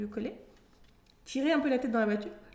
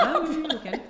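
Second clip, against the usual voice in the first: laughing; 7 semitones higher, 9 dB louder than usual. Real, not acted. Reacting to friend's laugh.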